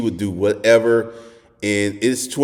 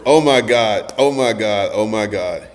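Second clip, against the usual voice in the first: ordinary talk, nothing marked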